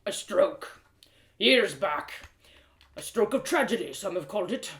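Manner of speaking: put-on a proud old man turning his misfortune over; gruff blacksmith, musing. Blacksmith tells of his stroke.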